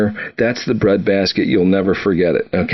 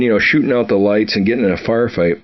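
normal talking voice

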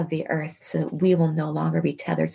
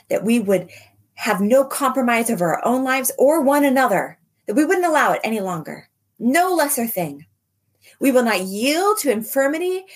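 rising, declaring prayer voice; roaring rallying speech; 4 semitones higher than usual. Acted. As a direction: proclaim it with rising force. Declaring over the congregation.

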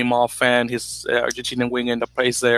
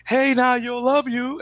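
high, eager fan call; calling out to a player; 11 semitones higher, much slower than usual. Retold or imitated. Fan calls out to the player as he passes, high and eager. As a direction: shout it across to him, star-struck.

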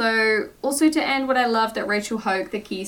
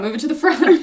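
"front" hit higher and louder; repeated louder, pushed; 5 semitones higher, 7 dB louder than usual. Shown as a demonstration. Host urging her co-host, repeating louder. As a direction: press the point again with more force.

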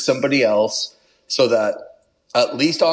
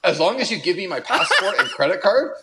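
laughing; 7 semitones higher than usual. Retold or imitated. Retelling his own panicked reply.

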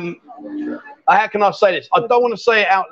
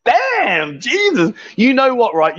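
ordinary talk, nothing marked

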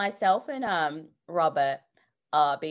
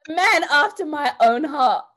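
excited; 11 semitones higher, 11 dB louder than usual. Real, not acted. Host delighted by guest.

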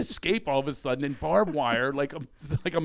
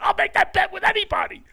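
much higher, louder shouted bet; loud proclamation; 6 dB louder than usual. Retold or imitated. Boasting to his friend, he shouts his bet. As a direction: proclaim it to everyone. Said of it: "I said".

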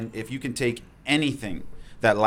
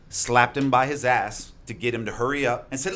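usual speaking voice

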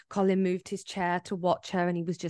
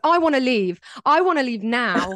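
louder, higher, firm retold demand to go; insistent demand; 4 semitones higher, 6 dB louder than usual. Retelling demanding the boyfriend leave the bar, loud and insistent. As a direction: insist on leaving right now, raised voice. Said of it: "I was like".

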